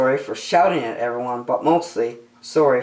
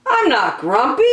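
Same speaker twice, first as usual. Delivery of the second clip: high, loud denial; insisting monkey; 9 semitones higher, 5 dB louder than usual. Acted. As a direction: Jim stamps out his protest. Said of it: "Jim insisted".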